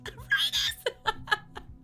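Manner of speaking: high silent wheezing laugh; wheeze laugh. Real, not acted.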